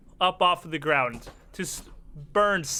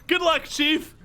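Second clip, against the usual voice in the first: high-pitched, drawn-out call; 12 semitones higher, 4 dB louder than usual. Acted. Fleeing ally wishes Lizard Man luck, calling out high. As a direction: toss a farewell while running off.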